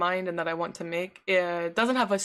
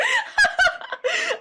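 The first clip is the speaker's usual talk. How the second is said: high shrieking laugh; 6 dB louder than usual. Real, not acted. Laughing after song.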